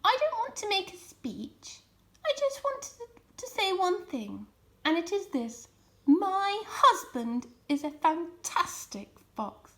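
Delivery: bashful wife toasting her husband; shy Missus Fox. A narrator's reading. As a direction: nervously praise her husband. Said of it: "got shyly to her feet".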